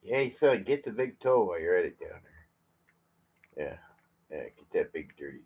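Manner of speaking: usual speaking voice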